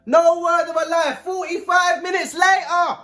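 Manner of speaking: high, stretched-out disbelief; incredulous. Real, not acted. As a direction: pitch jumps up, outraged at the wait. Excited storytelling, high and loud.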